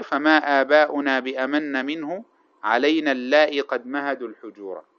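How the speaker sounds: slow, measured poetry recitation; chanted classical verse, slow and deliberate; reciting verse. Shown as a demonstration. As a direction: draw out each line of the old poem. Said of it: "قال الشاعر".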